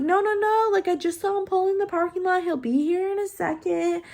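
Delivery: high-pitched voice of nurses holding someone off; high, hurried-sounding mock reassurance; imitated nurses stalling. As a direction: mimic staff urgently placating a patient. Retold nurses stalling the pushing mother.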